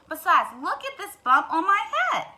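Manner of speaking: loud hen with a laugh; insistent hen. Acted. Hen shows the turkey her bump. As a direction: show off the bump.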